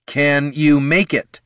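slow, high, insistent question; pointed repeat. A narrator's reading. Bard pressing Gorm again, slow and insistent. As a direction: press for an answer, word by word. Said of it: "he said again".

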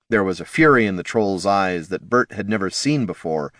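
ordinary delivery

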